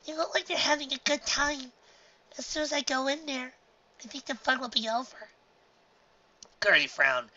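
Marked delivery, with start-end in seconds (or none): 0.0-5.3 high, timid filly; nervous little girl; young filly voice. A narrator's reading. Dinky's filly voice.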